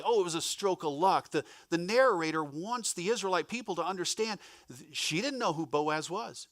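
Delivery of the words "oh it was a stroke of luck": casual lucky remark. Shown as a demonstration. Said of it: "Today, today we would say".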